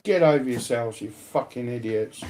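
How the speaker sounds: ordinary delivery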